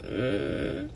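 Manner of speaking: faint moan through tubes; a feeble hum, barely voiced; weak moan. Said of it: "'cause I had tubes".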